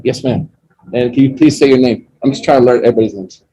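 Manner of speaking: usual speaking voice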